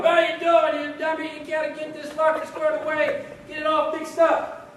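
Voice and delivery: high, loud drill instructor tirade; yelling. Acted. Training instructor behind Chandler over his locker, yelling. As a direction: bawl them out like a drill sergeant. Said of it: "just yelling".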